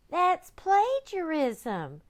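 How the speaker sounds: put-on high, slow chorus of kids; high, slow children's chorus; children crying out. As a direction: cry it out slowly like kids. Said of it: "the children cried".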